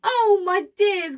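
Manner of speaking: high, slow, loud lament; old woman's dramatic outcry; wailing cry. A narrator's reading. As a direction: draw it out in dismay.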